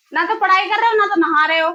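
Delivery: loud, high-pitched telling-off; real scolding. Real, not acted. Mother to child neither studying nor bathing, scolding.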